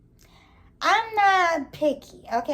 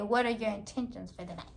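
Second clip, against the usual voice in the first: mock grown-man line; 6 semitones lower, 10 dB quieter than usual. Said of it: "ain't never heard nobody my age say".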